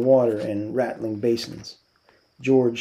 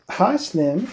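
bright flirty hello, pitched up; flirty greeting; 6 semitones higher, 3 dB louder than usual. A narrator's reading. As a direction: call out sweetly to Slim. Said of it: "calls out to Slim".

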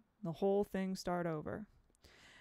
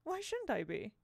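lightly amused teacher; 8 semitones higher than usual. Acted. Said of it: "Miss Foley laughed".

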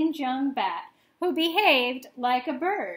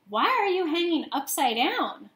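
loud voice; 5 semitones higher, 5 dB louder than usual. A narrator's reading. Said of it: "a loud voice said".

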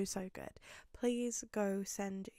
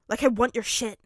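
loud, grudging dismissal; grumbled; 2 semitones higher, 12 dB louder than usual. A narrator's reading. Bakugo storming off from Midoriya, grumbling loudly. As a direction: grumble it with open irritation. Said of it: "he grumbled".

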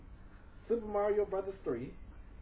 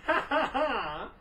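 echtes hohes Lachen; laut loslachen; lachend; 8 semitones higher, 5 dB louder, slower than usual. Real, not acted. Laughing at bird talking.

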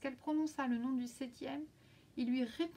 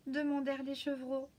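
usual speaking voice

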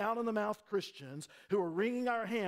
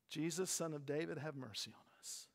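low, hushed plea dropping away; subdued, reverent prayer for mercy; quiet prayer; 4 semitones lower, 8 dB quieter than usual. Shown as a demonstration. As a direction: pray it softly, sinking almost to nothing. Models the shouted prayer softly.

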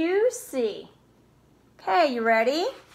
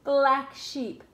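ordinary delivery

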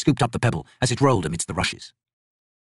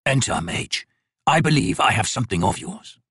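rasping voice. Acted. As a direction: rasp it out like a crippled thing. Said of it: "A voice rasped".

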